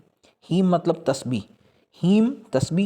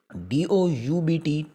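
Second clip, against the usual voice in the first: spelling out; 2 semitones higher, much slower than usual. Shown as a demonstration. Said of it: "इससे आगे है doubt".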